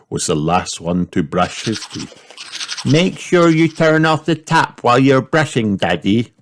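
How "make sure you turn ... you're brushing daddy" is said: put-on high little girl voice, a touch bossy; girl reminding father at bedtime; Peppa telling Daddy. A narrator's reading. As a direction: remind him like a tiny teacher. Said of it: "said Peppa".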